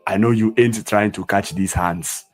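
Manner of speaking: every word fully sounded, "hands" with an open "ah"; unslanged pronunciation. Shown as a demonstration. Teacher showing what he doesn't say, "hands" as "hahnds". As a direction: say "hands" like "hahnts", native African accent. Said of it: "I don't say".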